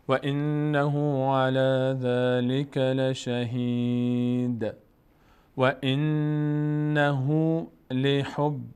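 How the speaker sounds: slow, drawn-out chanted Arabic recitation; slow Quran recitation. Shown as a demonstration. Teacher slowly reciting the verse for the student, chanted. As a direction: stretch every syllable as in tajweed. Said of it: "teacher recites full verse".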